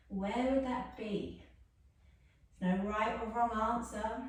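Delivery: soft, calm yoga-teacher guidance; gentle cool-down cueing voice; guided relaxation. Acted. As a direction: ease the class into stillness softly.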